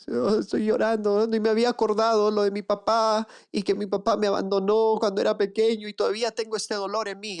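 voz imitada más aguda de quien descubre su dolor; cliente emocionado. Retold or imitated. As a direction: imita con ligereza a alguien que se quiebra. Imita a cliente que llora. (imitando a un cliente que se pone a llorar en la sesión, más agudo)

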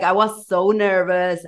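normal talking voice

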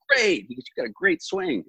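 very high-pitched imitated approval; retold enthusiastic praise. Pro golfer praising a young player's swing, enthusiastic. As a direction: quote the praise in a high, keen voice.

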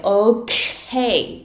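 OK with a long, forceful, breathy K; slow OK, bursting hard on the K; 思い切ったK. Shown as a demonstration. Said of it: "すっごいこう思い切ったこのクっ". (思い切り長く破裂させるKのOK)

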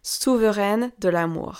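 Souveraine de l'Amour said the French way, soo-vuh-REN duh la-MOOR with a throaty French r; proud French pronunciation of Souveraine de l'Amour; French accent. Shown as a demonstration. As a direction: savour the name in native French. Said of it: "This is my French accent".

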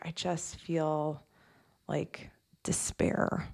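slow, near-whispered despair; hushed despair. Retold or imitated. Quoting a nurse client's despair, slow and hushed. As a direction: let the despair sink into a whisper.

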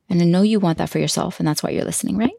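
usual speaking voice

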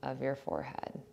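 soft, unhurried meditation voice with long pauses; guided meditation. Acted. Leads a body scan meditation.

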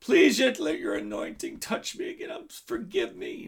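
acted cry for forgiveness, fading at the end. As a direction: plead as if imitating a desperate prayer. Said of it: "he's crying out to God".

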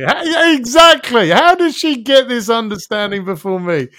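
hammed-up indignation at being beaten to it; indignant outburst. Retelling his wife's insight, he squeals in mock outrage.

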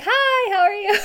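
high, laughing, hammed-up greeting; mock bright hello through a laugh; over-cheery hello. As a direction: play it sheepishly bubbly after getting caught. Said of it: "so I would be like".

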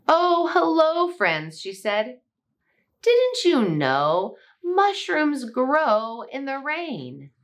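slow, teasing possum voice, high start; sly possum. Acted. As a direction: tease them slowly like a knowing possum. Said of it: "then she giggled again".

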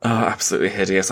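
ordinary talk, nothing marked

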